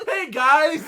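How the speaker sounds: high, loud, hammed-up greeting; loud character voice. Player teasing another's character voice, loud and high. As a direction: belt out a mock greeting. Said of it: "that's a character voice".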